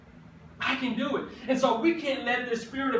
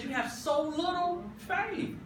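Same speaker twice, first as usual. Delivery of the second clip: higher, softer and slow; gentle Jesus; 6 semitones higher, 6 dB quieter than usual. Retold or imitated. Preacher voicing Jesus to doubting Peter, higher and softer. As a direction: let Jesus chide his follower gently. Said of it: "Jesus said".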